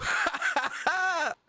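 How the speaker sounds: high mocking cackle; put-on high cackle. Shown as a demonstration. Streamer ends his soundbite with a high mock cackle.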